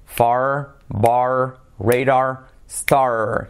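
very slow, word by word with gaps; slow repetition drill. Shown as a demonstration. Teacher asking learners to repeat, very slow model reading. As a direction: draw out each word, leaving room to repeat.

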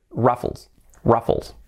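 ruffles as "RAF-fols"; English ruffles, not Spanish "RU-fles"; English pronunciation. Shown as a demonstration. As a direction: say ruffles with an "uh" first vowel. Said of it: "se dice ruffles".

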